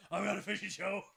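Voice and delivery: high put-on wrestler voice; Eric Young. Playing the wrestler boasting of a show, high-voiced impression. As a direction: push the voice up high and strained. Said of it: "I can't do an Eric Young".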